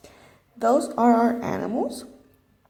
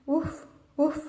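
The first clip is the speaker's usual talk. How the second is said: higher barking woofs; playful dog impression; 6 semitones higher, 5 dB quieter than usual. Shown as a demonstration. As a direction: bark like a puppy. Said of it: "It goes woof".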